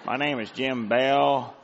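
slow, mock Southern drawl; Southern gentleman accent. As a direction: introduce yourself in a put-on Southern drawl. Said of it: "I'm a Southern gentleman".

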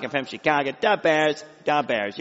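Chicago sports-fan twang through the nose; nasal Chicago accent. Shown as a demonstration. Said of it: "you had a little nasal talk".

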